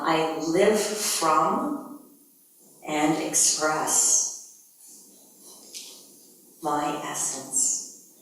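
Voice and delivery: drawn out with long pauses; paced affirmation; very slow statement. Shown as a demonstration. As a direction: say it slowly, phrase by phrase. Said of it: "a statement representing that element".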